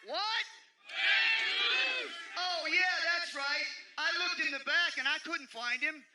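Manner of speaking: wrestling promo shout-out; calling out. Real, not acted. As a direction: bellow the challenge across the ring. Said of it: "Ken Shamrock has called out Moose".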